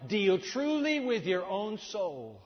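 loud, high, very slow solemn read; preaching at full force. Acted. Preacher reading the warning over music, loud and slow. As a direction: lay each word down heavily.

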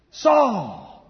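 long sliding summons; voice from heaven. Acted. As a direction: call the name from on high. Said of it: "he heard a voice say to him".

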